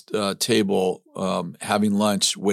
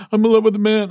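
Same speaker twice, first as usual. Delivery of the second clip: high, loud, hammed-up bawling; mock tearful wail; 12 semitones higher, 7 dB louder, faster than usual. Husband just home confessing to his wife, mock tearful wail. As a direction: imitate yourself blurting it out in tears. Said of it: "I'm in tears".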